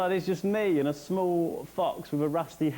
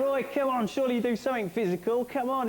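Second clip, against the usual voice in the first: coaxing high and eager; 6 semitones higher than usual. Retold or imitated. Coaxing Roy to be physical.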